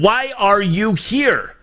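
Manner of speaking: imagined grilling of a witness, deliberately slow; pointed question. Retold or imitated. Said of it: "I would have said".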